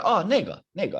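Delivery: 那 in 那个 high and falling sharply; pointing fourth tone. Shown as a demonstration. Teacher pointing at that one, high falling tone on 那. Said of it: "And then point at".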